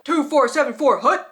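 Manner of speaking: loud, high snap count; quarterback call. Acted. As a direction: bark it like a football play call. Calling out like quarterback.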